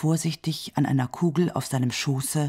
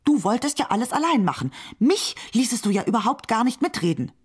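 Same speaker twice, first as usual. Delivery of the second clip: higher, fast, huffy complaint; irritated; 7 semitones higher, faster than usual. A narrator's reading. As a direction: rattle off the grievance, piqued. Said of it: "sagte die Linke gereizt".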